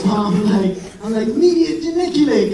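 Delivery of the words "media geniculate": higher, louder eager outburst; excited blurt. Retold or imitated. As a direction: blurt it out all worked up. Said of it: "'cause I get so excited".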